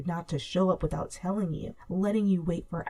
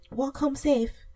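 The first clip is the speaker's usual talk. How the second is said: higher, raised call toward the door; calling after; 8 semitones higher, 4 dB louder, slower than usual. A narrator's reading. Aunt calling after the departing girl, raised voice. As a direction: send it after her as she leaves. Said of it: "she called after you".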